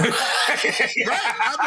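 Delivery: laughing out loud. Real, not acted. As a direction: laugh through the words. Laughing at his own joke.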